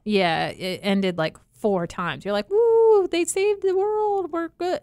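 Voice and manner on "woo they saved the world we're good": high, slow mock celebration; mock cheering. Acts out viewer cheering false ending.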